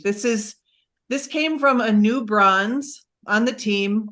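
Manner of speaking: normal talking voice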